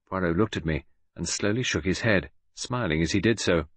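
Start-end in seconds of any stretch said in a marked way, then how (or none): none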